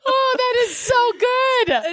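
delighted. Real, not acted. Friend delighted.

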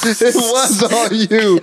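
high, drawn-out laughing jibe; laughing tease. Real, not acted. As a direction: tease a friend through real laughter. Teasing a friend over his mistake.

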